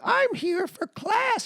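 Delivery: put-on slow high old lady voice; drawn out like an old woman. Old lady quoted from movie.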